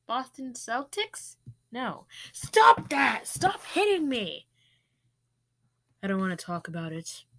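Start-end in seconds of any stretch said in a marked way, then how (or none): 2.3-4.5 high, loud mock protest; protesting yell. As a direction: cry out as if being hit. Acted protest at being hit.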